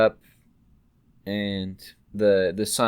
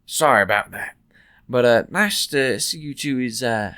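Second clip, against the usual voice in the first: cheery shopkeeper; 6 dB louder than usual. Acted. GM voices shopkeeper Barthen.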